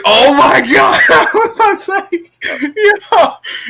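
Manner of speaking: high, loud, laughing shout; excited shout. Retold or imitated. Retelling reaction to mom's Ken doll remark, laughing shout.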